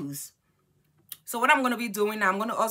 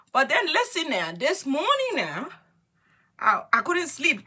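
high, loud and eager; excited; 8 semitones higher, 5 dB louder than usual. Real, not acted. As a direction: grab their attention with news. Excited announcement.

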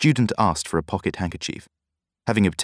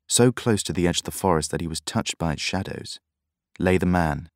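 plain reading voice

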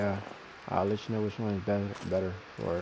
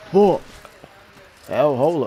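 loud outburst; 4 semitones higher, 9 dB louder, slower than usual. Real, not acted. Excited combat outburst.

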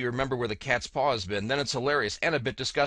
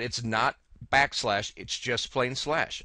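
slow, with "not" leaned on; corrective stress; slower than usual. Retold or imitated. Reading a viewer's correction, slow and stressed. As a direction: spell out "It's not backslash" slowly, pressing "not". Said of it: "Some were corrective".

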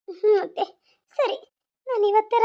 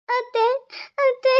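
higher, tearful, broken calling; crying; 5 semitones higher than usual. Acted. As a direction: sob out the call to her aunt. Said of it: "ಯಾಕೆ ಅಳಕತ್ತೀಯ". (ಅಳುತ್ತಾ ಅತ್ತೆಯನ್ನು ಕರೆಯುವುದು)

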